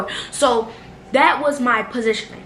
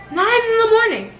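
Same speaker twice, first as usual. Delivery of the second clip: high exclamation; 11 semitones higher than usual. Acted. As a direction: stress hits "Nine", shot up high. Exclaimed repeat of the time.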